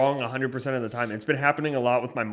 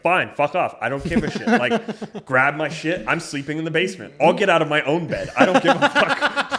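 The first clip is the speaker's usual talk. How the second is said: louder, laughing angry blow-off; retold angry outburst; 5 semitones higher, 6 dB louder than usual. Telling his girlfriend he's sleeping in the basement, angry. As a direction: storm off mid-argument, laughing at it. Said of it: "She kinda started raising her voice".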